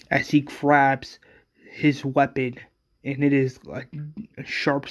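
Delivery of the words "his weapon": plain reading voice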